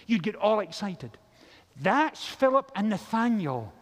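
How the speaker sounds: usual speaking voice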